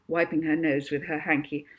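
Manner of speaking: plain reading voice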